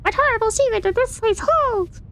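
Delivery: high squeaky object voice; bucket voice. Pretend. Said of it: "That's the bucket voice".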